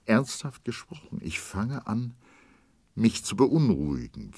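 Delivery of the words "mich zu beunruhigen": usual speaking voice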